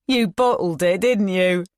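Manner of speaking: lightly voiced thug, mocking; a hint of a sneering gangster; Todd's taunt. A narrator's reading. Todd mocking Ian across his desk, lightly taunting.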